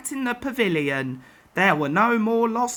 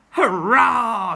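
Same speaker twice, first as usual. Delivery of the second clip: louder happy cheer; exclaiming; 4 dB louder than usual. A narrator's reading. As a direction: cheer at the rival's luck. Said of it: "she exclaimed".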